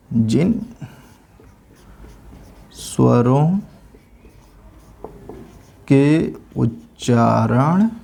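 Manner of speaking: word by word with long pauses, slow; slow dictation. Shown as a demonstration. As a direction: dictate slowly so students can write. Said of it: "तो इसमें note कर लेते हैं". (धीमे-धीमे लिखवाते हुए)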